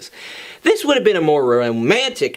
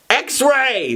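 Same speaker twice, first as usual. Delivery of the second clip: yell; 8 semitones higher than usual. Acted. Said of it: "Jean yelled".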